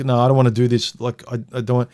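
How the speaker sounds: usual speaking voice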